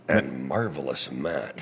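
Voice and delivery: very slow, low trailer announcer; movie trailer voice. Shown as a demonstration. Guest gives the other host a nickname, movie trailer voice. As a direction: stretch it out deep like a film trailer. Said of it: "I'll do the movie trailer voice".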